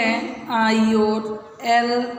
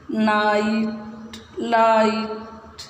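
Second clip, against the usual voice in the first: slow choral reading; much slower than usual. Shown as a demonstration. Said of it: "अब इनको इकट्ठे बोल के देखो".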